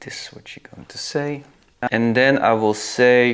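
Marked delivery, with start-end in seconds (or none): 0.1-1.4 whispering. Real, not acted. Reading out text while typing.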